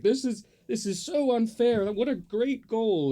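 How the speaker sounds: high, whiny sore-loser complaint; mock crying. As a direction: whine it like a sulking sore loser. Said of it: "they were crying. They were practically like".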